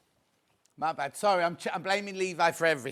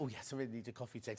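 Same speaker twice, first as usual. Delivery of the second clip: mock weary reply; 8 semitones lower, 13 dB quieter, faster than usual. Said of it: "And I went".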